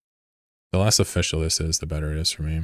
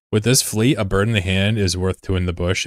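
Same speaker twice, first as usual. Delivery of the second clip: usual speaking voice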